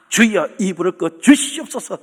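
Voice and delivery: mock impassioned plea to the Lord; imitated fervent prayer. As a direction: lightly act out a fervent churchgoer praying. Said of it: "그러면서 막 뜨겁게 기도한대요". (뜨겁게 기도하는 교인 흉내)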